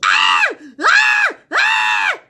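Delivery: high, loud animal screeching cries; imitated pterodactyl screech. Retelling the night with the dogs, loud high screeching imitation. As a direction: screech like a distressed creature in the dark. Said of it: "A screeching sound".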